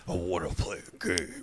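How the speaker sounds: low, quiet creepy puppet voice; Jigsaw voice. Retold or imitated. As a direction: rasp it like a horror villain. Jigsaw voice after request.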